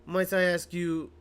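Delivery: put-on a stiff bellboy with a French accent; slow French accent. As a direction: deliver the message in a French accent. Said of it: "I get to do a French accent".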